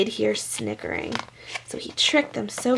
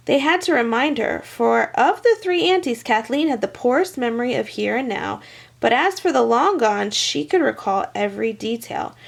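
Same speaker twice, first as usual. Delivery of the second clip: plain reading voice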